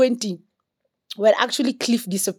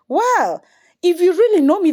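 heated retort; 8 semitones higher, 4 dB louder than usual. Retold or imitated. Retold heated phone quarrel with stranger.

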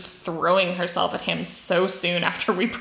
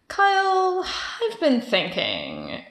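nervous hesitant opener; 6 semitones higher, slower than usual. Retold or imitated. Retelling nervous breakup opener.

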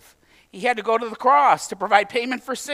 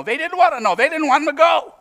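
louder, higher burst of emphasis; emphatic insistence; 8 semitones higher, 6 dB louder than usual. Real, not acted. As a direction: punch it out high and loud to the congregation. Emphatic restatement after measured aside.

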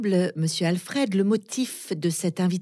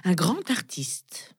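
usual speaking voice

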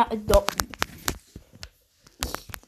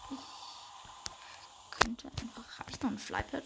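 whisper; 13 dB quieter, slower than usual. Real, not acted. Thinking aloud in game.

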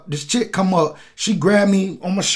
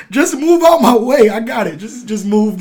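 raised, higher retold demand to move aside; impatient shooing; 6 semitones higher, 4 dB louder than usual. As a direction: imitate waving someone out of the way, voice pushed up. Acting out shooing someone away.

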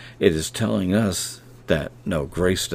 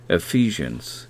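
ordinary delivery

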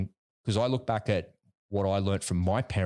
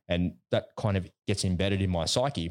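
usual speaking voice

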